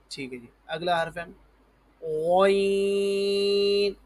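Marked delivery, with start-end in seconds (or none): 2.0-3.9 غین starting on a rounded throat gho, held high; heavy ghain. Shown as a demonstration. Teacher models for pupils, heavy drawn-out غین. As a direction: puff the mouth and stretch غین thickly. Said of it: "اس طرح کی آواز شروع میں نکلے".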